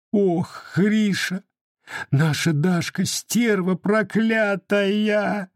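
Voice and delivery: high, slow tearful outcry; indignant tears. Acted. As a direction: cry out in angry tears. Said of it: "негодующие слёзы". (гневный плач сквозь слёзы)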